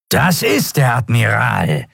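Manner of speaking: slow, rasping reptilian creature voice; snarling reptile under a stimulant's rush. A narrator's reading. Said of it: "schnarrte der Draxyl".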